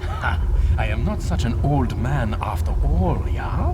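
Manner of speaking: loud, crowing laugh of victory; triumphant gloat. Acted. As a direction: brag like you just beat the young one. Old man gloats after winning race.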